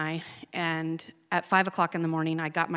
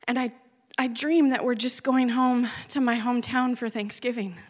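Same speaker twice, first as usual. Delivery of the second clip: ordinary talk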